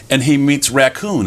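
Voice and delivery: ordinary delivery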